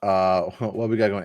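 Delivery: usual speaking voice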